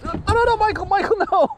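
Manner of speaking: very high, urgent cry of alarm; alarmed calling out. Real, not acted. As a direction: call him off in a panic. Friend mishandling the fish.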